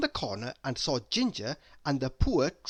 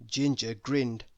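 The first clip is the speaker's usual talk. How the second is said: ordinary delivery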